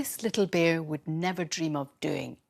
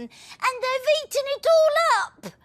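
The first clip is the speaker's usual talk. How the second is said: put-on child voice; 7 dB louder than usual. Little person voiced.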